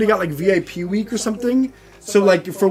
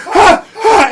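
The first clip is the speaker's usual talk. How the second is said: high frightened cries; panicked yelps; 12 semitones higher, 7 dB louder than usual. Retold or imitated. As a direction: yelp like a scared kid. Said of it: "I'm like".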